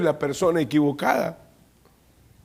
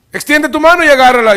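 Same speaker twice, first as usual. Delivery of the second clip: higher and louder divine command; booming command; 9 semitones higher, 10 dB louder than usual. Acted. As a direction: order him to grab it, full voice. God commands Moses to grab snake.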